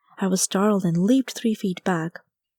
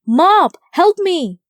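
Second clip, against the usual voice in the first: high, loud cry for help; desperate shriek, much higher and louder; panicked shout; 10 semitones higher, 9 dB louder, much slower than usual. Acted. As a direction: yell for help while fleeing a rhino. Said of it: "I shouted".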